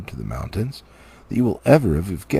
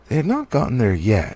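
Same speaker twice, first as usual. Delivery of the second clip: usual speaking voice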